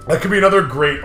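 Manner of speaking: normal talking voice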